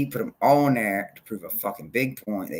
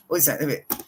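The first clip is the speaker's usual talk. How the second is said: ordinary talk, nothing marked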